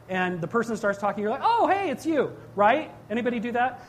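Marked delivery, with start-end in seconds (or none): 1.4-2.3 higher-pitched "oh, it's you!" greeting; pleased recognition. Acted. Driver answering a caller by voice, pleased recognition.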